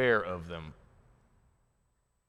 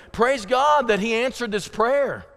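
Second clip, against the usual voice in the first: raised, louder cry of thanks; jubilant praise; 6 semitones higher, 4 dB louder than usual. Acted. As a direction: voice a believer rejoicing. Voicing a rejoicing believer.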